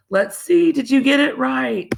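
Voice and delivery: playful excitement. Acted. Teacher building excitement.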